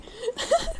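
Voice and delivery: high, soft giggle; amused laugh. Real, not acted. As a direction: giggle helplessly, keeping it light. Amused by remarks on own accent.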